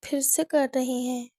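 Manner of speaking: usual speaking voice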